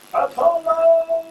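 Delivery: long, high yell of "Apollo!"; shouting. Pretend. Fan calling out a wrestler's name in a supermarket, long high shout. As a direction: bellow "Apollo!" across a supermarket.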